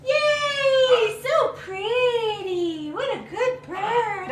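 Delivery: high, soft cooing to the bird; pet talk. Real, not acted. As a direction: coo at the bird, then cheer. Cueing bird's wing trick.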